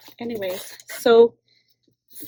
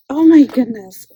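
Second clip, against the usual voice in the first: ordinary talk, nothing marked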